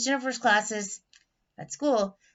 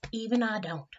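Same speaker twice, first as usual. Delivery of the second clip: ordinary delivery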